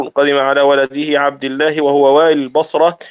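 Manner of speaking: ordinary talk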